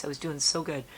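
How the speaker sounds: normal talking voice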